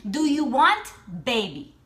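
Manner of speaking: pointed, insistent imitation of a pressing question; aggressive. Stranger pressing woman about wanting a baby, aggressive. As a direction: lean hard on the question, mildly aggressive. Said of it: "now she's getting aggressive with me".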